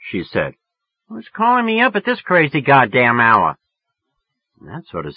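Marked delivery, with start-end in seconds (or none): 1.1-3.6 irritated woman, high and loud; near yelling. A narrator's reading. Woman woken by a phone call, nearly yelling. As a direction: scold the caller in a raised, higher voice. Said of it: "She was damn near yelling at me".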